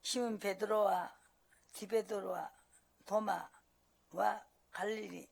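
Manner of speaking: slow, measured Bible reading; scripture reading. Acted. Pastor reading John to the congregation, slow and measured. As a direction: read the verses slowly aloud.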